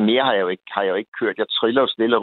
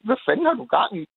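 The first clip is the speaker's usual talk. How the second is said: retold shout; 8 semitones higher than usual. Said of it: "så råber jeg efter ham".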